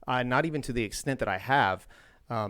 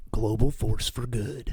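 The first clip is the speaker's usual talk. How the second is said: slogan read; 3 dB louder, slower than usual. Retold or imitated. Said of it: "You remember this catchphrase?".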